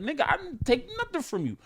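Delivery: high protesting voice of the accused; playing the guy swearing he took nothing; mock denial. A friend mock-protesting his innocence.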